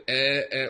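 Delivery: eh said as a short open vowel; Spanish eh filler. Retold or imitated. Teacher imitating the Spanish eh filler. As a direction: mimic the eh hesitation. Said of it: "If you say".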